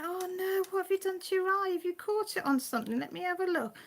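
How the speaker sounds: worried mum cooing at her cat's sore eye. Retold or imitated. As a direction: coo over the hurt cat like a worried mum. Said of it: "yes, I went".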